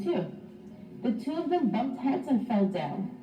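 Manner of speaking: ordinary delivery, nothing marked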